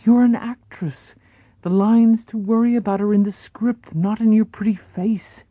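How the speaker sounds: put-on light European accent, gently comforting; soothing European accent. Paula comforting Marilyn, mild European accent. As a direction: reassure her in a hint of European accent. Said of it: "in her curious European accent".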